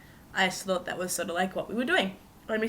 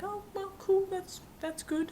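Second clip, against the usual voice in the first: high awkward squeak; 13 semitones higher, 3 dB quieter, slower than usual. Retold or imitated. Awkward reply retold.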